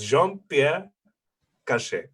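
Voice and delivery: slow French demonstration of the name; French accent. Shown as a demonstration. Jean-Pierre teaching his full name, slow French accent. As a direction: spell it out by ear, slowly, in French. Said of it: "say my full name with my accent".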